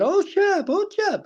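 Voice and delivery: imitating a concertgoer yelling a song title; retold crowd shout. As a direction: holler the request like a fan in the seats. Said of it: "a gentleman shouted that out".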